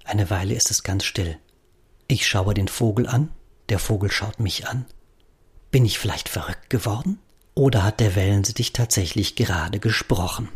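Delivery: ordinary delivery, nothing marked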